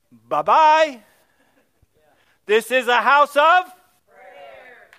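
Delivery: loud, slow, ringing proclamation; a booming farewell, then a slow shouted declaration; defiant shout. Retold or imitated. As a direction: bellow it at the people walking out. Pastor mocks leavers, proclaims.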